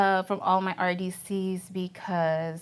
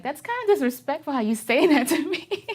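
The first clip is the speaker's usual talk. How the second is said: higher, louder retold protest with a laugh; mock offended; 7 semitones higher, 5 dB louder than usual. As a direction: act put out, half laughing. Retelling her reaction to being called female.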